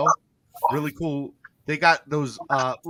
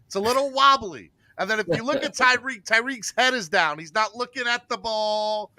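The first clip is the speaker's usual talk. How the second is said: higher-pitched mock analyst breakdown; 3 semitones higher than usual. Host mimics a channel analyst dissecting a throw, mock-earnest. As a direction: pick the play apart with put-on seriousness. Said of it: "do my T D impersonation".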